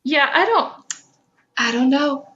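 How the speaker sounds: normal talking voice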